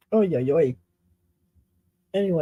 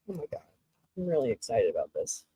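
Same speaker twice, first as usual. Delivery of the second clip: hushed and quick; 10 semitones lower, 12 dB quieter, much faster than usual. Real, not acted. Hushed aside.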